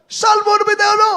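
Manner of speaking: high, fast loud cry; shout. Retold or imitated. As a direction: call it out urgently. Shouting the psalm in battle.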